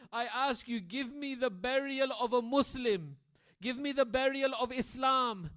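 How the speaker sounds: high, loud, grieving plea; loud mourning wail, voice straining high; wailing lament. Acted. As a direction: cry out the martyr's last wishes. Martyr's final wishes lamented.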